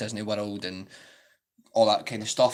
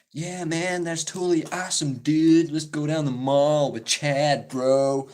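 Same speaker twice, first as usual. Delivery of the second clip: high-pitched American mall dude impression; bad American accent; 5 semitones higher, 3 dB louder, slower than usual. Shown as a demonstration. Vlogger showing viewers their best attempt, exaggerated American accent. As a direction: put on a hammed-up, dragged-out American bro accent. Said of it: "sorry for destroying the American accent".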